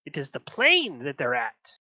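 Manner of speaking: lightly voiced imp. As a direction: pipe up as the imp, pitch leaping mid-phrase. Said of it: "the imp ... looks up and says".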